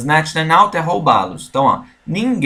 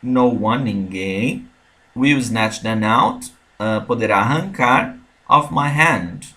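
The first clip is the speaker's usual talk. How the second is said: ordinary talk, nothing marked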